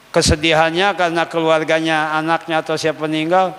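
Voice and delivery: ordinary delivery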